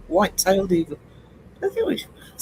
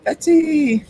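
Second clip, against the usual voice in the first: cheery greeting call; 10 semitones higher, 4 dB louder than usual. Real, not acted. Greeting a chat viewer.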